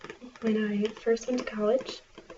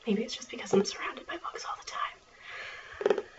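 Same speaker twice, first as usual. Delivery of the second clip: library whisper. Acted.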